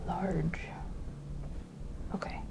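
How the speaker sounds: soft unvoiced whisper. Real, not acted. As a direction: breathe the word out. Whispered echo.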